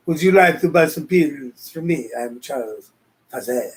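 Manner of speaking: lightly put-on French accent, pitch dropping low on the name; playful French accent salesman offering pills; mock French accent. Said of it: "See, it sounds French".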